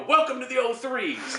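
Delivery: slow, loud drill instructor taunt; loud gung-ho soldier. Retold or imitated. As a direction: announce it like a gloating drill sergeant. Drill instructor mocking open contracts.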